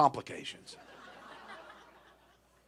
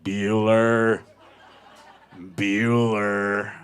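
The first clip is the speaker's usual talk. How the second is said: low, loud, drawn-out monotone; deadpan crowd echo; 6 semitones lower, 7 dB louder, much slower than usual. Retold or imitated. Everyone at the dog park answers the owner, low monotone drone. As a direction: drone the name low and long.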